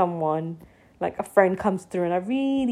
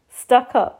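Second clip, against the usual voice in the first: "Stuck up?" louder and voiced, half-offended; indignant disbelief; 3 semitones higher, 7 dB louder than usual. Friend says she looks stuck up, louder indignant echo. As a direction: throw back "Stuck up?" with full voice.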